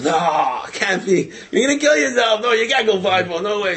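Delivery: retold horrified objection; protesting crowd. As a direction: voice onlookers pleading him out of it. Crowd protests the blindfold stunt.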